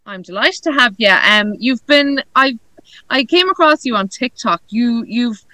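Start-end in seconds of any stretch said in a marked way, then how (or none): none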